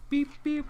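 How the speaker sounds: beep sound. Retold or imitated. Phone notification sound.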